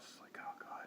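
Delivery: hushed dread, barely a breath; sinking whisper before going back there. Real, not acted. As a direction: drop to a whisper like you're bracing for the worst. Reacts to news of the guy.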